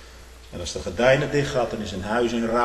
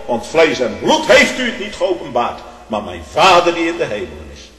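full-force preaching; 6 semitones higher, 11 dB louder than usual. Acted. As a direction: proclaim the blessing loudly.

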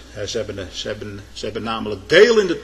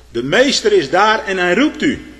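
raised, higher call to a sister; 7 semitones higher, 7 dB louder than usual. Retold or imitated. Martha calling her sister Maria, loud and high. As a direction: call her sister loudly across the house.

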